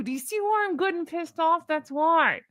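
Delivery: talking through gritted teeth; angry, through clenched teeth. Real, not acted. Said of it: "I'm talking through gritted teeth".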